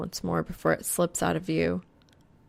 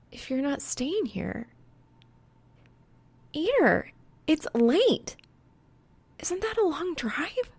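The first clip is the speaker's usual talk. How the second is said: put-on higher, anxious young woman; worried girl voice; 10 semitones higher than usual. A narrator's reading. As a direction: voice her concern, pitched up.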